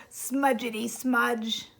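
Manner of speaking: ordinary delivery, nothing marked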